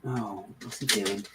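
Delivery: ordinary talk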